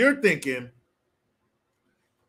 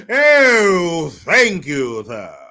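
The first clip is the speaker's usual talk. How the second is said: mock grovelling gratitude; 8 dB louder, much slower than usual. Grateful peasant thanking the leader, loud mock grovelling. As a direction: gush thanks like a servile subject.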